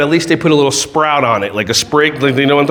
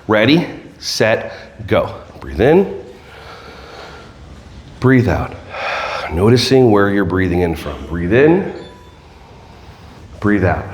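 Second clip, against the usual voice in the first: guided breath work; 6 semitones lower than usual. Shown as a demonstration. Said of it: "noticed the calmness in me".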